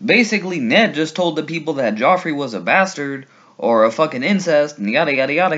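normal talking voice

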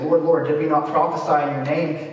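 usual speaking voice

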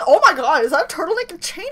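ordinary talk

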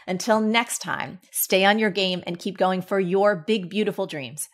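podcast outro. Acted. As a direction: send the listeners off with a pep talk. Host's closing outro.

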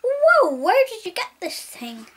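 loud, amazed whoa and question; eager shouted question; excited. Acted. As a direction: gush with wonder at the plane.

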